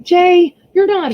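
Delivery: calling out. Real, not acted. Wife calling husband to stop helping the kid, loud call. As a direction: call him out sharply.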